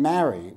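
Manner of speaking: "marry" with the short a of cat, stressed; East Coast pronunciation. Shown as a demonstration. East Coast speaker showing the wedding word, short-a "marry". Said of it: "which is, you know, to enter bondage".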